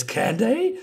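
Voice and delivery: high, rising mock question; acted questioning reply. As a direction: play the oblivious drunk asking back. Acted drunk man's oblivious reply.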